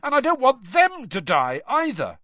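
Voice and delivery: high, loud pleading yell; desperate yell. Acted. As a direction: yell it, desperate not to leave her. Harry pleads with the mermen.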